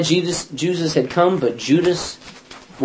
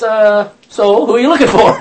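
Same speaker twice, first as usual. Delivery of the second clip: mock casual question; 6 semitones higher, 8 dB louder than usual. Jesus asks again, teller amused.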